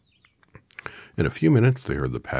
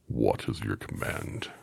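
low, slow, solemn voice; bow and ask it gravely; monkey king; 6 semitones lower, slower than usual. A narrator's reading. Monkey King asks Dorothy her command in a low voice. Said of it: "I remember what his voice sounded like".

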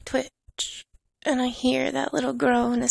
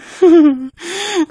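a high, loud gleeful laugh; delighted laugh; 6 semitones higher, 10 dB louder than usual. Acted. Mistress revelling in teasing her pet, a gleeful laugh.

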